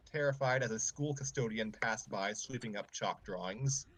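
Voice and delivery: usual speaking voice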